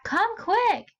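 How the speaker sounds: high, urgent plea; excited call. Shown as a demonstration. Teacher playing Zane urging his mom to hurry. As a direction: hurry her along, thrilled. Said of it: "Zane bellowed back".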